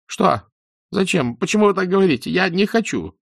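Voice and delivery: high, loud, terrified outburst; panicked. Acted. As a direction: panic as if cornered. (в панике, высоко и громко)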